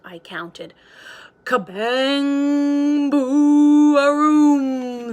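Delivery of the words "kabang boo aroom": loud, higher thunder roar; growling thunder. A narrator's reading. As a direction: roar it like a storm. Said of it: "the thunder growled".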